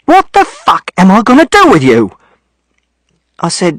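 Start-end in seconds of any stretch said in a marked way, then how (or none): 0.1-2.0 high, strained angry outburst; father losing his temper; furious exasperation. A narrator's reading. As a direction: hit it harder, pitch pushed right up. Exasperated father, angrier second time.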